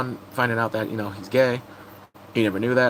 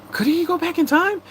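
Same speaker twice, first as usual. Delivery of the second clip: much higher eager rising question; excited question; 3 dB louder than usual. Retold or imitated. Vlogger recalling her hopeful theory, much higher and rising. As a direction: ask it with bursting hope. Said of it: "I'm like".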